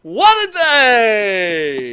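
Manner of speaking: very slow, high, loud exclaim; catchphrase. Retold or imitated. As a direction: stretch it out loudly. Joe's catchphrase echoed.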